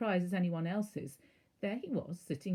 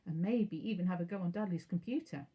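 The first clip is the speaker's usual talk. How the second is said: usual speaking voice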